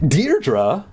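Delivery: puzzled, lifted one-word question; surprised. A narrator's reading. Counselor hears the missing girl's name, surprised. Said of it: "narrowed his eyes in surprise".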